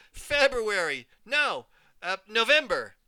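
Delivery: high, loud eager guesses; excited yelling. Acted. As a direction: call out names like a little kid. I'd yell when I was little.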